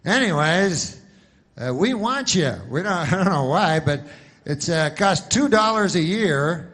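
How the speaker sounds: loud, high, hammed-up insurance ad voice; mock TV-ad huckster; pushy commercial pitch. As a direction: sell it like a cheesy TV spokesman. Continues mock spokesman ad.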